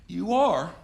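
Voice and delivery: sarcastic surprise at her star sign; mock surprise. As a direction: feign shock at her answer.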